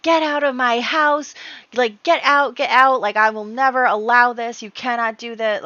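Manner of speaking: loud, high hammed-up shouting; very angry Earl. Earl throwing everybody out of his house, acted angry shout. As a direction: throw them out with mock fury. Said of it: "he's very angry".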